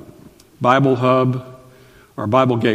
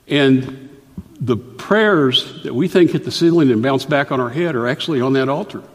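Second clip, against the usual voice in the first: ordinary delivery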